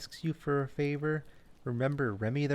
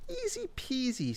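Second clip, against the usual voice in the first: much higher, carefree; breezy raccoon; 13 semitones higher than usual. Acted. Remy cheerfully agreeing to the errand, much higher. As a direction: toss it off lightly, no trouble at all. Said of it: "said Remy".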